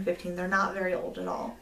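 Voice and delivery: normal talking voice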